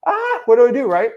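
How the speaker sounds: high, loud overwhelmed cry; mock frantic outburst; exasperated. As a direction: throw up your hands, stuck. Himself stuck choosing a market.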